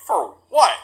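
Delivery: high, loud disbelieving question; incredulous. Retold or imitated. Answering officer about a search warrant, incredulous. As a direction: burst out in re-enacted disbelief.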